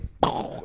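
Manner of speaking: mouth-made gun blast; voiceless burst mimicking a shotgun effect; imitated shotgun noise. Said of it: "There's, like, a shotgun noise".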